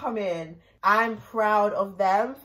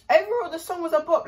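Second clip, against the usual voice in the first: excited; 8 semitones higher, 5 dB louder than usual. Real, not acted. Hyped verdict on song.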